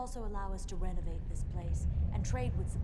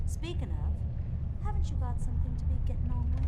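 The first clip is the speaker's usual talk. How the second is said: curt dismissal; 6 semitones higher, 5 dB louder than usual. Acted. Brushing off the questioner.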